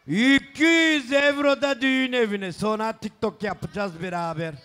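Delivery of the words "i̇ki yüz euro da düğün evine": booming wedding announcer. Acted. Wedding gift money announcement.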